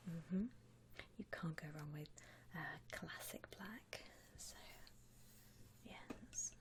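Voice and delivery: ordinary talk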